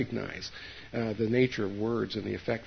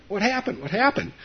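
put-on much higher, childlike worried voice; worried kids asking; 10 semitones higher than usual. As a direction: pipe up like an anxious little kid. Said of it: "My friends come along".

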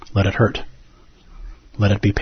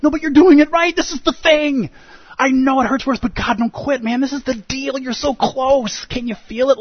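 cheering coach; 14 semitones higher than usual. Pretend. Teacher encourages the hurting dragon, in a high, eager coaching voice. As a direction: urge him on like an excited coach. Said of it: "You're like".

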